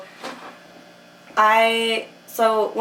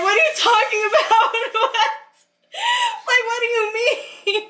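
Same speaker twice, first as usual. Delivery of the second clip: high, laughing disbelief; bewildered laughing; 13 semitones higher than usual. Retold or imitated. To him, stunned that it's nothing, high laughing disbelief. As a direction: squeal in confused disbelief. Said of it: "start crying".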